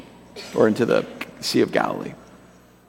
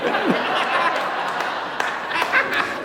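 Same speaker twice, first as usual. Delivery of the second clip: high, helpless laughter; long wheezy laugh. Real, not acted. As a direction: crack up at your own story. Cracks up at his own story.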